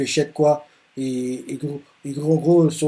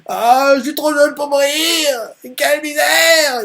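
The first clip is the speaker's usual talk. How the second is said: high, loud tearful wail; hammed-up sobbing panic, much higher and louder; crying out in despair; 12 semitones higher, 5 dB louder than usual. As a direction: wail like a kid sure he's about to die. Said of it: "il est en train de crier comme ça, les larmes aux yeux".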